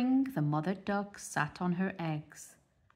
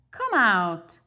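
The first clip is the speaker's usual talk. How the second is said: calling out; 2 semitones higher, 9 dB louder, slower than usual. Acted. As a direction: call the ducklings out loudly. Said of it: "called Mother Duck".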